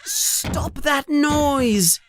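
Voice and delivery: high, urgent plea, held back in volume; restrained yell at someone making noise; mild acted yell. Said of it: "Harry yells at the creature".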